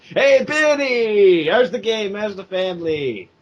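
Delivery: slow, high, hearty barfly greeting; friendly bar regular. Retold or imitated. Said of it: "a guy who's always at a bar just hanging out, who sounds really nice".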